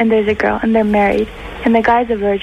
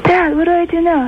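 high, pleading son voice; clueless son; 7 semitones higher than usual. Acted. Son on a walkie-talkie asks Dad for help, high and helpless. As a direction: call to Dad for help, pitched way up. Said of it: "And then he goes".

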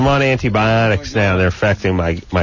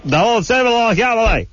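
high-pitched garbled yelling; high, babbling mock shouts; 12 semitones higher than usual. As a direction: holler it high, words mashed into gibberish. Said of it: "Will you stop yelling".

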